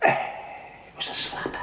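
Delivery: breathy hushed whisper, slow. Acted. Host confides to listeners in a slow whisper. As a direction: let it out like a secret.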